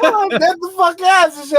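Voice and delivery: high, loud laughing insistence; laughing plea; insistent pleading. Retold or imitated. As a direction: beg to be believed.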